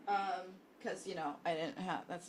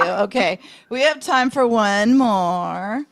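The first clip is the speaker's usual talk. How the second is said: ordinary talk, nothing marked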